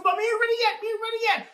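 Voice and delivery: high, whining trickster voice; whiny refusal. Acted. Nancy refusing Tukuma, high whiny voice. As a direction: stall him, sulky and high.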